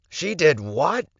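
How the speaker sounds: high, loud disbelieving shout; shocked yell. Acted. Lorenzo hearing the Alpha was poisoned, shocked loud yell. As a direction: blurt it out in shock. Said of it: "Lorenzo yelled".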